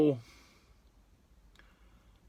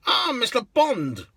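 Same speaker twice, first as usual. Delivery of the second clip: slow, much higher and louder, drawn-out hail; delighted greeting; 11 semitones higher, 6 dB louder than usual. Retold or imitated. Cabbie hailing Pierce Brosnan as he gets in, hammed-up delight. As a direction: greet a film star with theatrical relish.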